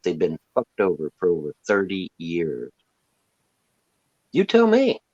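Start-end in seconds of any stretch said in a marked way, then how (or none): 4.3-5.0 taunting challenge. Real, not acted.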